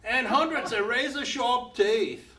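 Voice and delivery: mock admiration. Narrator mocking the hunter's praise of the shark, laughing sarcasm. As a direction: gush ironically over a scary shark. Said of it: "And, and I said".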